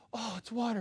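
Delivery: soft, suddenly quiet realization; calmed-down wife. Retold or imitated. Wife learning it is only water, quietly calmed. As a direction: drop to a small, soft voice.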